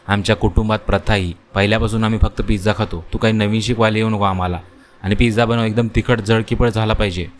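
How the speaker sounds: ordinary delivery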